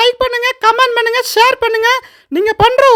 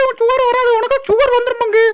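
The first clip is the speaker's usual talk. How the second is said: ordinary delivery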